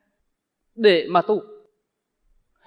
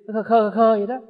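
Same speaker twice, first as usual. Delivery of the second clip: odd daft "khơ khơ khơ" in a changed voice; silly mock chuckle; 3 semitones higher, 4 dB louder than usual. Monk mocking a muddled practitioner's vacant chuckle. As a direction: imitate a dazed monk's simple laugh. Mimics silly laughing sound.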